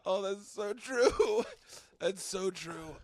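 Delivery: high, slow, breaking into laughter; crying laughing. Real, not acted. Reacting to co-host's crude joke, crying with laughter. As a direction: wheeze it out helplessly.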